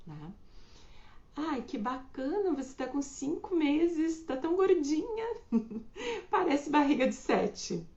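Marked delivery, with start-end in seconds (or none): 1.4-7.8 high, sugary mock gush with a laugh; mock sweet gushing. Imitating a gushing acquaintance, high and sugary. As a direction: gush sweetly while poking at her weight.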